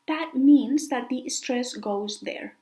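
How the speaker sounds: normal talking voice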